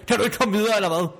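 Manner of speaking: high-pitched, fed-up question; exasperated challenge. Acted. Quarrel, fed up with opponent. (irriteret udfald i højt toneleje)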